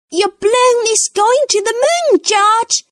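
put-on very high, fast little-girl voice; excited Peppa. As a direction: announce it with excitement. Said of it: "says Peppa".

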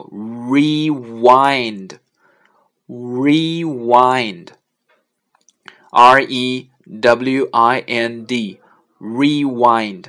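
"rewind" drawn out in two long syllables, re-wind; slow word demo. Shown as a demonstration. Teacher teaching the player button word rewind, slow spelled-out demonstration. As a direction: stretch "rewind" and spell its letters slowly. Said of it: "还有一个词叫".

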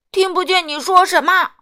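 very loud, high holler; booming shout. A narrator's reading. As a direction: boom out over the customer. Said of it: "说起话来轰隆隆的". (轰隆隆地高声大吼)

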